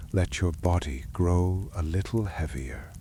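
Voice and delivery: ordinary delivery, nothing marked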